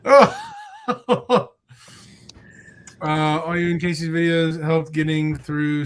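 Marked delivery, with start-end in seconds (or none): 0.0-1.5 laughing cry. Real, not acted. Laughing and crying out.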